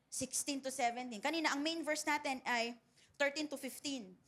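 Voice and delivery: ordinary talk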